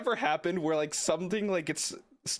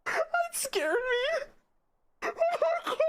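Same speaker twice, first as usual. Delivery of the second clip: high, breathless shrieks of laughter, close to tears; hysterical laughing. Real, not acted. Gamer startled by a scary sound, laughing hysterically. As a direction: laugh in shock until it almost turns to crying.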